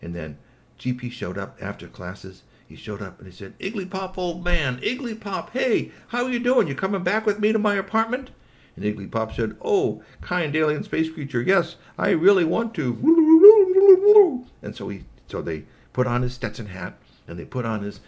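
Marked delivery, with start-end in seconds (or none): 3.6-8.3 much higher, bright cheery greeting; a jolly buddy calling out; cheery friend. Acted. GP greeting Igglypop after classes, much higher. 9.6-14.4 very high, loud, slow alien voice; eager alien. Acted. Igglypop accepting GP's invitation, very high and loud.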